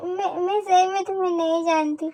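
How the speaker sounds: soft, tearful begging; tearful pleading. Acted. Frightened girl begging to be let go, tearful pleading. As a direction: plead quietly through tears. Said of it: "तू रोती भी है".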